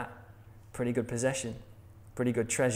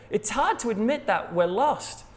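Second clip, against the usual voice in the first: ordinary delivery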